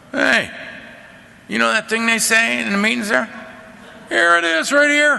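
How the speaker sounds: high, loud, thrilled to find it in the book; excited discovery. Retold or imitated. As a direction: call out like you just found it. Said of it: "one of us would go".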